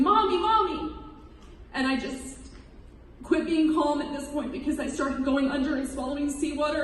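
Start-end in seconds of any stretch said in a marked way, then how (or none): 0.0-0.9 high-pitched mock scream for mother; retold child's scream. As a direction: call out high, like a child on shore. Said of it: "who was on the shore screaming".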